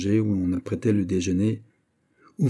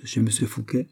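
ordinary delivery

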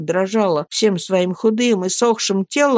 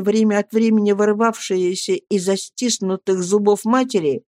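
ordinary delivery

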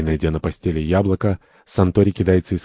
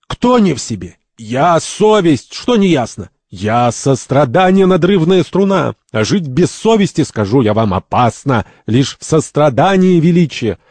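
high, indignant declamation; indignant retort; 8 semitones higher, 4 dB louder than usual. Acted. First springs up from the bed to object, indignant. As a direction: fire back in lofty outrage. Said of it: "в возмущении вскакивает с постели и с негодованием возражает".

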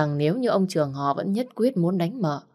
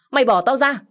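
higher, louder shout to let him go; angry shout; 8 semitones higher, 4 dB louder than usual. Acted. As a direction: yell it out as he fights free. Struggling to break free. (hét lên giận dữ, cao và to)

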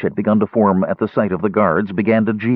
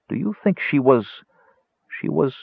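higher, halting question with pauses; falter, unable to finish the question; 5 semitones higher than usual. A narrator's reading. Cleric asking about the hurt woman, faltering. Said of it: "Danubis faltered".

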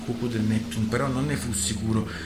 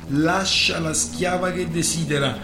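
louder, higher addressing a crowd; loud proclamation; 6 semitones higher, 6 dB louder than usual. Acted. Lorenziglio to the pirates, loud proclamation. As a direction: announce the ruling to the rabble.

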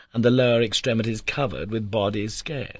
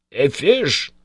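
louder, higher burst of excitement; excited cry; 5 semitones higher, 7 dB louder than usual. A narrator's reading. Uncle spots the catch, excited cry. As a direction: blurt it out on spotting the fish. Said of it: "cried my uncle".

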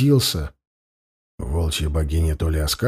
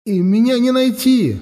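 desperate self-assurance; 10 semitones higher than usual. Acted. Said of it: "словно убеждая сам себя".

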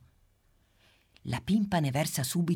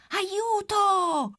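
very high, loud squeaky yell; chick's cry for help; 5 dB louder than usual. Acted. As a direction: scream for help as you flee.

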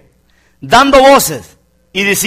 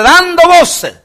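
shouted; 9 semitones higher, much slower than usual. Acted. Preacher shouting scripture.

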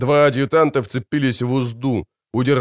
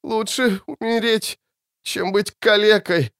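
slow, fading pleading; sedated, words dragging; slowing speech; 8 semitones higher than usual. A narrator's reading. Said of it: "раненый говорил всё медленнее".

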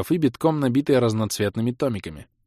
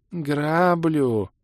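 deadpan elf; 5 semitones higher than usual. Acted. Elf voiced.

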